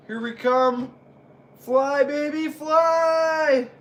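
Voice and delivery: high, building to a shout; wrestling commentator. Acted. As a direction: build the call to a scream.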